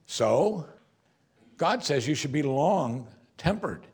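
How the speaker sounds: normal talking voice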